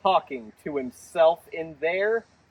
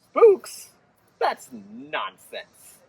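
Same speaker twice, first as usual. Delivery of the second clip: incredulous scoff; 7 semitones higher, much slower than usual. Acted. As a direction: scoff at the silly idea. Jane scoffs at spooks.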